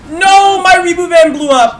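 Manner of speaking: loud high dismay. Real, not acted. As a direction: wail the loss. Van destroyed.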